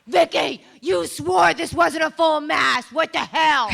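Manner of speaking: loud high yell across church; imitating a loud church outburst; shouting. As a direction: bellow her name, slow and loud. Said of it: "You were literally going".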